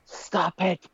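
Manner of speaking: high mock scolding from the wife; lightly voiced wife telling him off; husband mimics his wife's high, annoyed "stop it"; imitating the annoyed wife. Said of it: "Kara's like".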